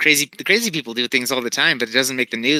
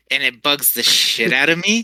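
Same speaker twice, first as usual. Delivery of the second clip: normal talking voice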